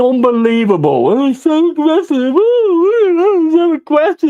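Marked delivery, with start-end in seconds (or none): 1.1-4.3 high, whiny sneering mimicry; mocking the pundit. Fan mocking the TV pundit, high whiny sneer. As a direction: parody the pundit as a prissy whiner.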